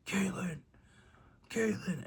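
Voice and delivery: high, loud imitation of a frantic dad; panicked calling out. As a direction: call the name like a worried father bursting in. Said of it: "he's panicking and he's freaking out".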